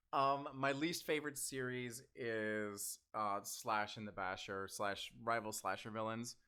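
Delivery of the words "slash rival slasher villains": ordinary talk, nothing marked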